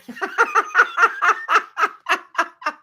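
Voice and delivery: long, rolling laughter. Real, not acted. Laughing at the Toyota rep's reply.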